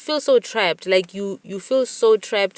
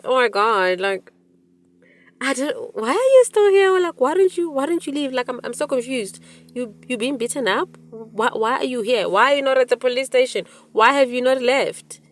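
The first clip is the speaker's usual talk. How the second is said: shocked disbelief; 7 semitones higher, 3 dB louder than usual. Retold or imitated. Retold shock at friend's abuse.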